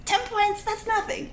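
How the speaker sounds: high, breezy brush-off; dismissive scoff. Retold or imitated. Shrugging off the system's penalty, high and airy. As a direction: toss it off in a high voice. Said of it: "Shen Qingqiu's like".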